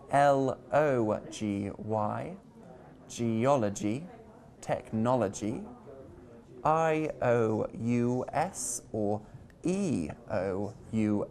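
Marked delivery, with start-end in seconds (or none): 3.1-5.6 "geology" and "technology", stressed on OL and NOL; stress before the suffix. Shown as a demonstration. Teacher demonstrating the rule, "geology" and "technology" stressed before the ending. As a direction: put the weight just before "ogy" in "geology" and "technology". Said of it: "stress the syllable before the ending".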